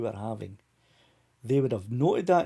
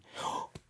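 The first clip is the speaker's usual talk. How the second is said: mock gasp; 11 dB quieter than usual. Al's wife might be watching.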